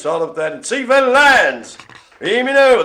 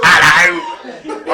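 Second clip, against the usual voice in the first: louder squeaky puppet impression; Zippy voice; 7 dB louder than usual. Host imitating Brian's robot as Zippy. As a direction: squawk it like Zippy from Rainbow. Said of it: "Now it's Zippy of bloody Rainbow".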